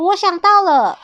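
high, loud eureka cry; excited realization. A narrator's reading. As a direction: burst out with a new idea. 阿黄突然想出办法. (兴奋地高声宣布)

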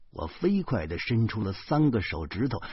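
usual speaking voice

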